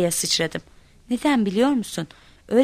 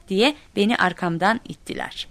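plain reading voice

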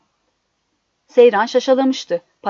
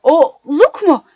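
loud, drawn-out choking cry; outraged, strangled shout; choked outcry; 4 semitones higher, 6 dB louder, slower than usual. A narrator's reading. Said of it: "boğulurcasına".

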